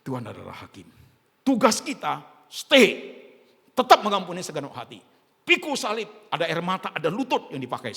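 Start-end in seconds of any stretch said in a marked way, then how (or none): 1.5-7.9 preaching at full force. Acted.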